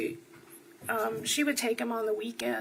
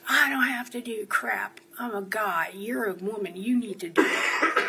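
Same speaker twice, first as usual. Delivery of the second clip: imitating a belligerent brother, louder on key words; mock defiant brother; 5 dB louder than usual. Sister retelling her brother's drunken refusal to clear up, mock defiant. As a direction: throw it back as an indignant, retold refusal.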